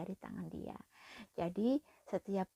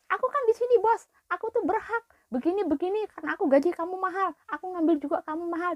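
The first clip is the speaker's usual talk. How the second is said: much higher, louder sneering boss voice; mocking boss; 11 semitones higher, 9 dB louder, faster than usual. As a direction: lord it over the maid. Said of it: "Ngata-ngatain aku katanya". (meniru majikan yang mengejek)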